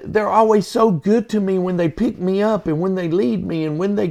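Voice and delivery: put-on gushing like a grateful old patient; patient bragging about his EMTs to family; beaming patient. Patient praising the crew, voiced.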